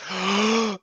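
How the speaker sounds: sharp horrified gasp; re-enacted gasp of dismay; retold gasp. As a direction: suck in air in mock shock. Reacting to seeing the sticker.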